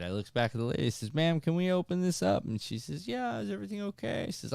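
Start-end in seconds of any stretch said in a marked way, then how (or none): 1.1-2.5 high, loud urgent plea; mock-frantic child asking for help; imitated panicked kid. Son pleading with the ride attendant, high mock panic. 3.1-4.2 very high, quick female voice; imitated ride attendant. Attendant checking on the worried boy, high quick imitation.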